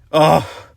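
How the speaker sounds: loud high startled grunt; jolted grunt. Acted. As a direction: caught short with a jolt. Jolted mid fall.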